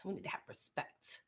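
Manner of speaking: hushed whispered repeat. Shown as a demonstration. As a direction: whisper it for emphasis. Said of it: "repeated as whisper".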